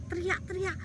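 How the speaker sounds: ordinary delivery, nothing marked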